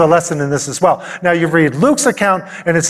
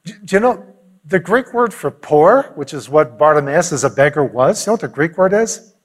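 normal talking voice

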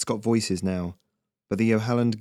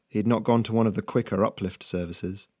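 ordinary delivery

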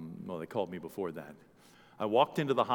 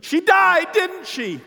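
sudden high, loud outburst; distraught cry; 15 dB louder, much slower than usual. Acted. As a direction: cry it out as a stricken relative. Acting distraught relative at hospital.

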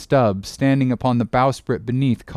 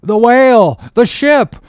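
high, loud cry of alarm; cringing cry; 9 semitones higher, 7 dB louder than usual. Acted. Oarsmen sighting the whale at the ship, cringing cry. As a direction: shout it in terror. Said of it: "cried the cringing oarsman".

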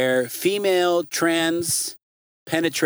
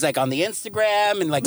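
ordinary talk, nothing marked

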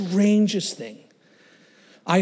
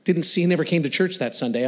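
ordinary delivery

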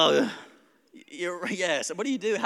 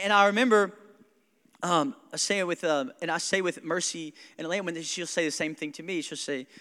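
ordinary talk, nothing marked

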